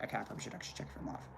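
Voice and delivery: fast murmured aside. Real, not acted. Fast barely audible aside.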